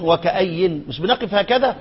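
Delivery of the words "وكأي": وكأي said "wa-ka-ayyin"; stop on the nun. Shown as a demonstration. Teacher asking how we pause, وكأي with final n. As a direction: let وكأي end on n. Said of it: "على النون".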